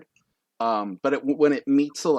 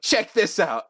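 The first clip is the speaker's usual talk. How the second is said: higher-pitched showman's call; showy boast; 8 semitones higher, 3 dB louder than usual. Acted. Contestant showing off to the crowd, showy. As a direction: announce it like a show-off.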